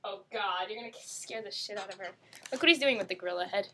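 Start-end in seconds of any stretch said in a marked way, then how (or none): none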